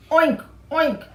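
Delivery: loud, high oinking; pig oink. Acted. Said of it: "said Missus Pig".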